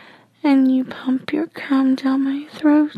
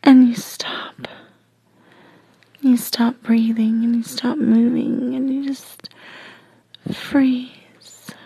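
plain reading voice

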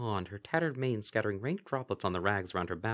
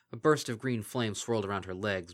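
ordinary delivery, nothing marked

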